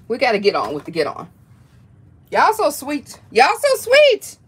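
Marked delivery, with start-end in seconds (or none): 2.3-3.2 gently raised, affectionate coo; touched cooing. Real, not acted. Touched, thanking gift givers. 3.3-4.4 very high, drawn-out squeal; gushing squeal. Real, not acted. Repeats it, more overwhelmed.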